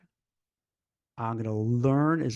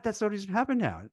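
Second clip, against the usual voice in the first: normal talking voice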